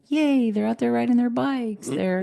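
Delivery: high, gushing fan voice; mock cheerful. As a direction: gush like a cheery follower. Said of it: "Everybody just sees this, like".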